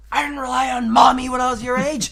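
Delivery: high, loud mock-dad yelling; dad's indignant shout. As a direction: imitate a dad shouting in self-defense. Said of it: "he fucking sits there like".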